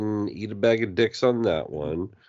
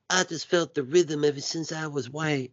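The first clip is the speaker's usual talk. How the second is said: higher-pitched movie character imitation; 6 semitones higher, 3 dB quieter than usual. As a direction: put on the earnest drumline kid's voice. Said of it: "and he's like".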